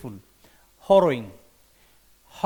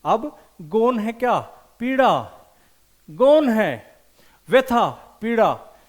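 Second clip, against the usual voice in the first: emphatic drill; 4 semitones higher, 6 dB louder, slower than usual. Shown as a demonstration. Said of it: "teacher hammering the mnemonic".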